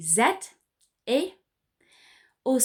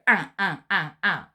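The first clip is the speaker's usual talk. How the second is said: nasal duck sound; 4 dB louder than usual. Shown as a demonstration. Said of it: "So give it a go".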